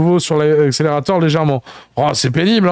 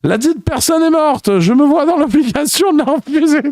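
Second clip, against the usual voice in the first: solemn cop reply; 9 semitones higher than usual. Acted. Cop answers solemnly via megaphone.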